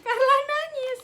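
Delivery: high, loud squeal of laughter; laughing mock alarm. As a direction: announce it like juicy gossip, cracking up. Mocking message, laughing loud.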